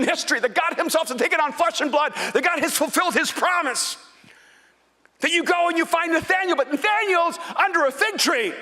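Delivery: high, loud fervent preaching; fired-up pulpit delivery; preaching at full force. Acted.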